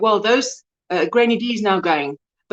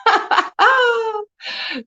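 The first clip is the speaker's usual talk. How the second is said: high delighted laugh; 12 semitones higher than usual. Real, not acted. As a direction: burst of happy laughter.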